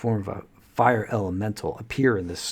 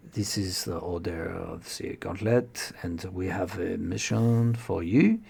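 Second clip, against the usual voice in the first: put-on halting French-accented captain's briefing; English with a thick French accent, pausing between phrases. Captain briefs adventurers on their mission, French accent. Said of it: "French accent".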